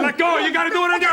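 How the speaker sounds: shouting. Acted. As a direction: yell it to push them off the edge. Urging partner to jump.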